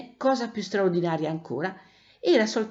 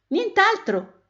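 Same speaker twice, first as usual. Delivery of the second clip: very high, tiny cat voice; thin little voice; 12 semitones higher, 3 dB louder, slower than usual. A narrator's reading. Hidden chalk cat answers Gelsomino from the wall, thin little voice. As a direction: pipe up in a small, thin high voice. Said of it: "una vocetta sottile sottile".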